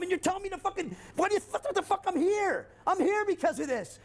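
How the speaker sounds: very high-pitched, strained retort; exasperated outburst. Retold or imitated. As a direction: explode in disbelief at the shrink. Acts out outburst at his psychiatrist.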